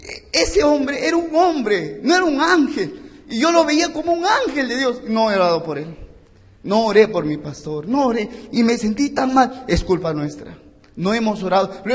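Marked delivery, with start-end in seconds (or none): 0.0-9.6 anguished confession. Retold or imitated. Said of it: "ella me dice".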